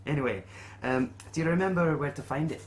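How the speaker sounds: lightly voiced quest-giver Willie; lightly put-on voice, lifted at phrase starts; innkeeper Willie. Innkeeper offering adventurers payment options, lightly voiced character.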